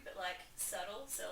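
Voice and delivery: ordinary talk, nothing marked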